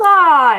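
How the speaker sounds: loud, high imitation shriek; mock horrified scream. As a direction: let out a hammed-up scream of alarm. Retold Kimmy's reaction, runs away.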